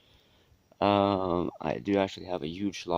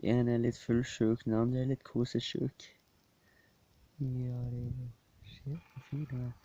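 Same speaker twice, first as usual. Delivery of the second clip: pet talk; 5 semitones higher, 5 dB quieter than usual. Real, not acted. Talking sweetly to the cat.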